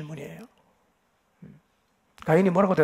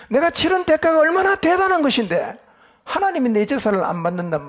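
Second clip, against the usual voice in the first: rising, louder and higher aggrieved protest; indignant outburst; 6 semitones higher, 4 dB louder than usual. Retold or imitated. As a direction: act out the proud man's angry self-pity. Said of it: "이러면서 화를 내는 거". (점점 높고 크게 억울해하며 화내듯)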